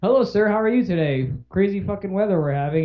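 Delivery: chirpy interviewer voice; perky news reporter. Retold or imitated. Reporter greeting a stranger at gas station, chirpy.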